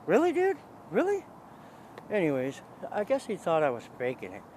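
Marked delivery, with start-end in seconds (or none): 0.1-1.2 high, louder disbelief; retold disbelief, pitched way up; incredulous. Disbelief that friend never returned.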